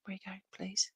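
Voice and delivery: whispered plea. Real, not acted. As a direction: breathe the question, unvoiced. Repeats question in a whisper.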